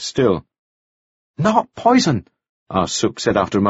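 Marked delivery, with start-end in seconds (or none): 1.4-2.3 high-pitched denial in broken English; accented protest. A narrator's reading. Ah Suk denies the accusation to Mannering, accented protest.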